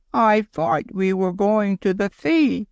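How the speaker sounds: high, slow, near tears; a small boy about to cry, mild; almost crying. A narrator's reading. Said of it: "he said, almost crying".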